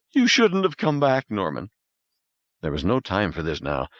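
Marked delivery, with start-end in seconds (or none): 0.1-1.7 high, breaking woman's voice; tearful refusal. Acted. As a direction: refuse through sobs. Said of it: "Crying like a child".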